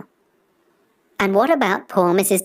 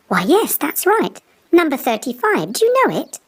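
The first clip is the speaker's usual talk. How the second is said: put-on high, trusting girl's voice; lightly voiced little girl; 9 semitones higher than usual. Girl giving the wolf the number, high and trusting. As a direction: chatter innocently, pitched up.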